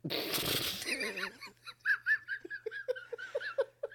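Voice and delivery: high, helpless laugh; burst of laughter. Real, not acted. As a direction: crack up at the joke. Bursts out laughing at episode title.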